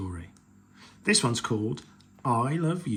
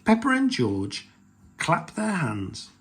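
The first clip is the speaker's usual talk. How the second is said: ordinary delivery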